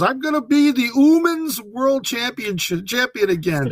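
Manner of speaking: put-on light Japanese accent on the quote; imitating Shida's accent. Host mimics wrestler's English for co-hosts, light accent. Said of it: "she still cannot say the word woman".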